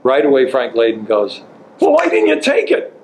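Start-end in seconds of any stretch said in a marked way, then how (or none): 1.8-2.9 gleeful teasing. Retold or imitated. Friend Frank Layden reacts, laughing.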